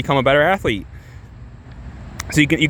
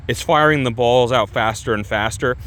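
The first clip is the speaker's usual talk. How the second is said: normal talking voice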